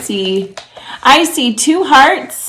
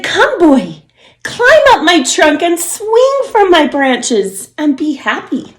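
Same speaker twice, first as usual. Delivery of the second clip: higher, joyful tree calling; loving tree; 6 semitones higher, 3 dB louder than usual. A narrator's reading. Tree welcoming the boy back, higher joyful voice. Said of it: "And she said".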